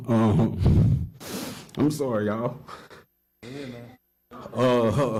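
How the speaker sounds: real emotion in a public testimony, barely keeping composure; choked up. Real, not acted. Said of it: "sorry if I get emotional".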